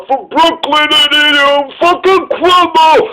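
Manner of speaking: imitating a Brooklyn guy, hammed-up; Brooklyn accent. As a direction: drag it out in a thick Brooklyn accent. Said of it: "that's my Brooklyn accent".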